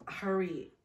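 ordinary delivery

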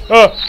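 sudden high yelp of fright; startled scream. Real, not acted. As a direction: yelp as the monster appears. Monster jumpscare.

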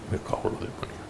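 breathy muttered oath under the breath; whispered curse. Retold or imitated. Recognising his old classmate, a whispered curse. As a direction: whisper it to yourself in disbelief.

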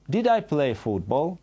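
ordinary talk

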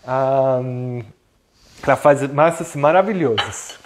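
ordinary delivery

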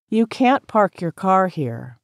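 ordinary talk, nothing marked

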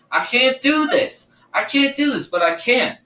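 high, frightened pleading; real panic rising in the voice; panicked. Real, not acted. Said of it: "I'm genuinely scared now".

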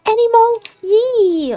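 hammed-up fury at the invulnerable enemy; mock angry outburst. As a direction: sputter in playful outrage. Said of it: "Then I got angry".

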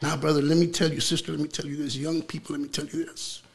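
normal talking voice

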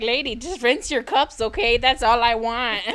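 loud, laughing plea about the cups; half-laughing complaint; exasperated roommate. Retold or imitated. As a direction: daughter nagging her mother about cups. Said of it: "But I'm over here like".

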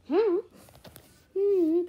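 ordinary delivery, nothing marked

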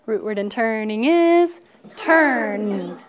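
"Root word in turning is turn" said slowly, stressing "is"; slow modelled answer. Shown as a demonstration. Teacher modelling the answer for students, slow and deliberate. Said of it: "Choppers out".

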